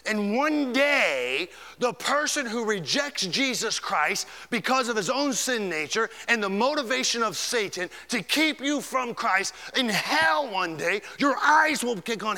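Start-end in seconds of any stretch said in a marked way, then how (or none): none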